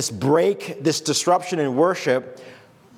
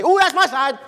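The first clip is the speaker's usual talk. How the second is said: mock partisan cheer; 8 semitones higher, 4 dB louder than usual. Mocking people picking the winning side.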